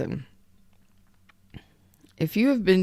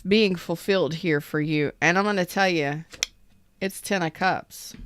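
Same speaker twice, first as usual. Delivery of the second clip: usual speaking voice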